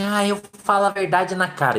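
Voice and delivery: a hint of a proud person bragging about bluntness; mocking blunt people. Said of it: "As pessoas falam assim".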